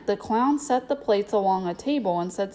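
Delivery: plain reading voice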